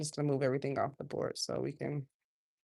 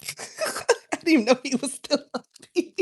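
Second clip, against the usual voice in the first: repeated through real laughter, pitched way up; giggling disbelief at Wolverine still being there; laughing; 10 semitones higher, 8 dB louder than usual. Real, not acted. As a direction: crack up while saying it again.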